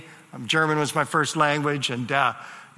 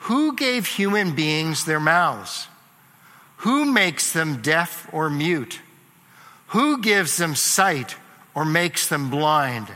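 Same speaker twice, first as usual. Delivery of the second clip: slow solemn reading; slower than usual. Acted. God's questions read slowly.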